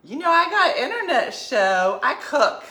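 high, loud, drawn-out flirtatious greeting; flirty mom impression.

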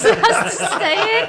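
loud, high laughing outburst. Real, not acted. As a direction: crack up while getting the words out. Hosts crack up over running joke.

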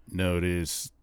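lightly imitated machine voice; eerie single-word machine message; voice box. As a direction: intone it like a spirit box. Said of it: "the voice box says".

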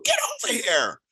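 put-on high, urgent call to a child; a parent yanking a kid back from traffic; sharp parent warning. Parent stopping kid at the road.

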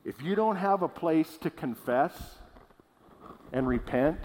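deliberate, clearly separated phrases, rising at the end; slow and clear. Real, not acted. Preacher warning the church about confessing, slow and clear. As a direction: spell it out slowly, with pauses. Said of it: "say this very slowly and clearly".

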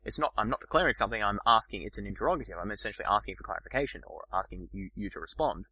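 ordinary delivery

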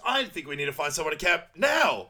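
retold princess losing her temper; freaked-out outburst. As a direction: snap it out as a loud order. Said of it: "she freaks Donkey out".

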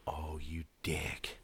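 hushed, breathy aside to himself; whispered thought. Retold or imitated. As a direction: mutter it under your breath, caught out. Inner thought while waiting, caught.

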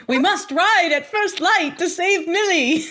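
slow, high mock-heroic battle cry; hammed-up epic proclamation; Enzo. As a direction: declaim it grandly, on the edge of laughing. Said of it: "And instead he could say".

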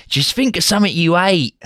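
high, hammed-up Cockney; mock Cockney hooligan. Hooligan coaching the newcomer before the fight, mock Cockney.

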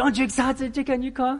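high hopeful asking; eager question. Retold or imitated. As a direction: push for her excitement. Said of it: "I said".

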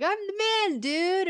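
a hint of a high bro boast; lightly mock the party dude; light mock bro swagger; dudebro brag. Mocking the flag-dressed dude.

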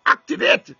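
loud, ringing call to activate; shouted command. Real, not acted. Preacher commanding the congregation, shouting. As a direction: fire the word out at the church.

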